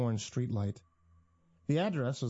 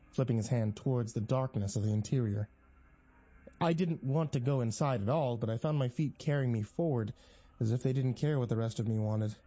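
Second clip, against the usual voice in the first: ordinary delivery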